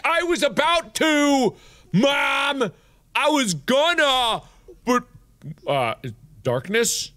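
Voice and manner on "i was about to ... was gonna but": high, mock-indignant child's complaint; whiny kid protest. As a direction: protest to Mom like a thwarted kid. Protesting to game mother.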